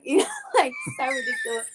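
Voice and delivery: high, laughing through the words; real laughter. Real, not acted. Storyteller laughing at her own rude comebacks, helplessly amused. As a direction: crack up while talking, pitch shooting up.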